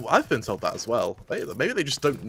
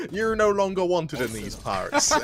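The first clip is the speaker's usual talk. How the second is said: mock Western sheriff; 6 semitones higher than usual. Mock outlaw banishment line.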